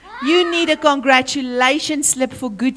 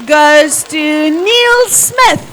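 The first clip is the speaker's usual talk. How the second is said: slow, drawn-out winner reveal, a little louder; drum roll announcement; 4 dB louder, much slower than usual. Shown as a demonstration. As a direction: stretch the name like a prize announcer. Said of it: "drum roll".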